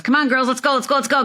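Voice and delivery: lightly voiced impatient father; hurrying dad. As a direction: hurry the girls out the door like a dad. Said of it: "'cause that's what dads do".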